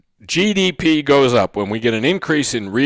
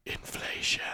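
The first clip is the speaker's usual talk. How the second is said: hushed, voiceless reveal; whispered; 18 dB quieter than usual. Real, not acted. Teacher naming the long-run result to the class, whispered. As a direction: whisper the word. Lets the dreaded word drop in whisper.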